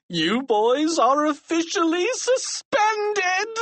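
high, slow, savored pronouncement breaking into cackles; gloating, drawn-out high shout; gleeful shout. A narrator's reading. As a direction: crow it slowly with delight. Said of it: "Mister Krupp shouted with delight".

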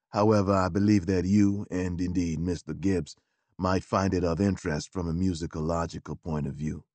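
a low, deferential gentleman's gentleman; valet Beauregard. Acted.

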